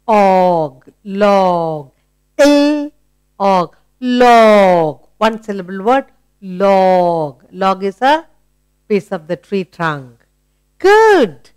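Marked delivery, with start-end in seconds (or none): 2.4-5.1 "L" then "Og" as separate chunks, then blended into "Log"; sounding out. Shown as a demonstration. Teacher to child showing how to blend the sounds of log. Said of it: "What is the sound?". 10.8-11.5 "Good" thrown up much higher, louder and bright; excited praise. Real, not acted. Teacher to child, delighted high praise.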